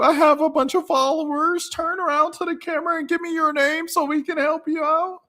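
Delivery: squeaky, very high hammed-up weeping imitation; mock crying. Reactor parodying the gaslighting woman who snitched, mock crying. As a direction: wail a pretend tearful excuse in a shrieking falsetto. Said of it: "How you gonna cry and say".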